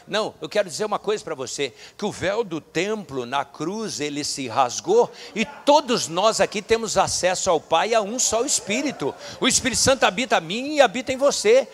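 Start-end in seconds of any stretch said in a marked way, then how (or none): 4.0-11.7 loud, building sermon climax; fiery preaching. Acted. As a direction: preach it full force, crowd rising.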